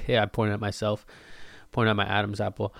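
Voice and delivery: normal talking voice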